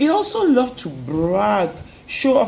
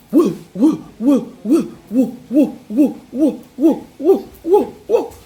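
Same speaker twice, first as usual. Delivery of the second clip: spinning wheel whoosh; 5 semitones higher, 4 dB louder than usual. Acted. Spinning wheel sound effect.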